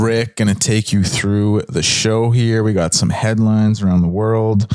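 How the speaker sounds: ordinary talk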